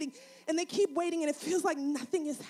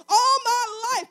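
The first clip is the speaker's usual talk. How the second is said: loud, slow, tearful cry; a preacher's tearful wail; 7 semitones higher, 10 dB louder, slower than usual. Real, not acted. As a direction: break into a sob on it. Preacher crying out in sermon.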